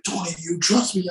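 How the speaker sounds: high, urgent imitation of a director; retold intense pleading; in-your-face director. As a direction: press an actor for trust. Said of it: "he jumps in my face like".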